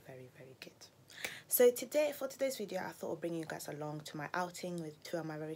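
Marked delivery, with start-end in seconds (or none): 0.0-0.7 whisper. Real, not acted. Whispered end of intro.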